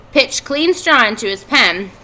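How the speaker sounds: usual speaking voice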